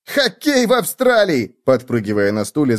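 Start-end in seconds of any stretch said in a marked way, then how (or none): 0.1-1.6 high, drawn-out incredulous squeal; bouncing, high bug exclamation; excited cry. A narrator's reading. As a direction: cry out in high-pitched disbelief. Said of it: "закричал Клоп".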